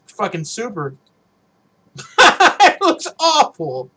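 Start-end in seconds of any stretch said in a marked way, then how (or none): 2.0-3.9 high, loud burst of laughter; real laughing. Real, not acted. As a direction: crack up loudly while talking. Laughs at the dress-up result.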